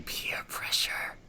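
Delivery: slow, soft whisper; eerie, drawn-out whisper; whispered inner voice. Acted. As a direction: whisper it like a voice in the head. Voices a voice in the head.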